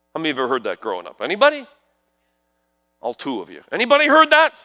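ordinary talk

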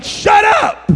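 high, loud comic outburst; exasperated mock yell. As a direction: cut off the cheering with a yelp. Jesus silences cheering disciples.

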